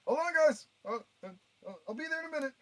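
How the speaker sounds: high strained voice calling ahead; high whiny call. Pretend.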